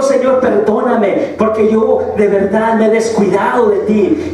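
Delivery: ordinary talk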